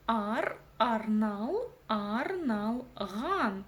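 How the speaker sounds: "ар, арнал, арналган", each piece drawn out; teacher's slow syllable drill on "арналган"; syllable build-up. Shown as a demonstration. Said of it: "нужно разделить по слогам".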